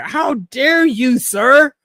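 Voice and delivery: high, slow, hammed-up indignation; mock outrage. Fan acting out his shock at a heel turn, mock outrage. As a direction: play a scandalized gentleman. Retelling betrayal outrage as quote.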